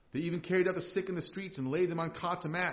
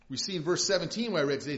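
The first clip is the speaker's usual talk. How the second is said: normal talking voice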